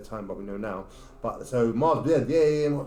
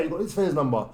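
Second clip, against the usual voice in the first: usual speaking voice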